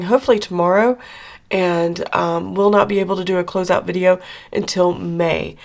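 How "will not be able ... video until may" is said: ordinary talk, nothing marked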